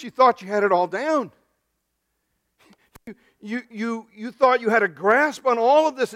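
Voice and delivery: impassioned preaching; preaching rebuke. Acted. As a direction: press the point home to Nicodemus. Rebuking Nicodemus at full force.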